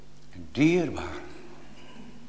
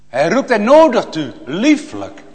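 louder and higher, preaching hard; fervent loud proclaiming; 7 semitones higher, 10 dB louder than usual. Real, not acted. Preacher proclaiming God's call to the congregation, loud. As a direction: proclaim it with full force.

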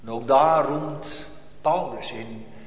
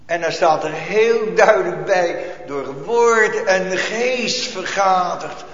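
louder, rising pulpit voice driving the point; full-force preaching; 4 semitones higher, 6 dB louder than usual. Acted. Preacher rousing the congregation, full pulpit force.

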